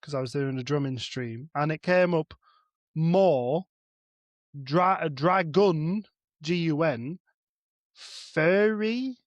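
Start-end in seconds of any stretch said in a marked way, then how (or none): 3.0-9.2 MORE, DRAGON, G U N, FURRY said slowly as separate, punched chunks; deliberate read-out. Retold or imitated. Chef reads the AI's botched spelling to co-hosts, deliberate read-out. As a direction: spell out the garble word by word with pauses.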